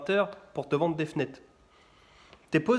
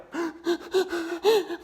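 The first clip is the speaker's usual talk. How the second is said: put-on whiny child gasp; 14 semitones higher than usual.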